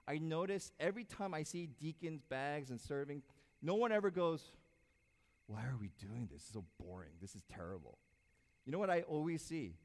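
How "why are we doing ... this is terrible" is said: lower, softer, whiny grumble; mock bored complaint. Pastor mimics a complaining deacon, lower sulky grumble. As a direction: mumble it like a bored volunteer.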